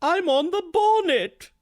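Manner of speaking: high, drawn-out upper-class British film voice; quoting Depp's Mordecai. Retold or imitated. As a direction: play it like a Wooster-style British toff. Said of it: "one of my favorite lines in the film".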